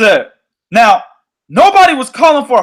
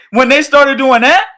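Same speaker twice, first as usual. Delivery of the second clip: ordinary talk, nothing marked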